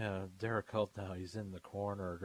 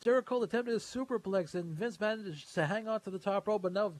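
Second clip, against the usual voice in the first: wrestling commentator; 10 semitones higher, 5 dB louder than usual. Acted. Play-by-play of top rope action.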